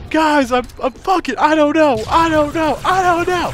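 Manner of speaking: high, loud panicked stammer; frantic high-pitched panic; wigging out. Retold or imitated. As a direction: imitate a friend freaking out, rising in pitch. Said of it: "like, wigging out".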